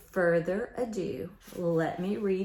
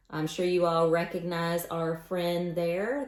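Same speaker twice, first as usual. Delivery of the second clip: ordinary delivery, nothing marked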